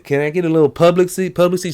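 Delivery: high, fast put-on voice; mock man asking. Man bugging a breastfeeding woman, fast high mock voice. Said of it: "he said".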